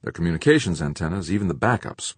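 plain reading voice

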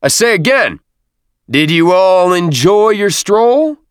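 high, loud parade-ground shout; drawn-out officer's yell. A narrator's reading. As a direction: shout the question slowly across the ranks. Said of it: "put his fist on his hip and shouted".

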